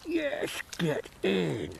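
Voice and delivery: muffled excited cheer. Real, not acted. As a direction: yelp with the mouth full. Fish landed, camera in mouth.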